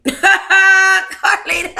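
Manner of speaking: high laugh bursting out over the name; real delighted laugh. Real, not acted. Vlogger cracking up reading Carlita's comeback, high delighted laugh. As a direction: crack up at the character's line. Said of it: "I got that same attitude".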